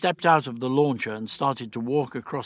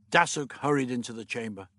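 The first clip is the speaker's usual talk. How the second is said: ordinary delivery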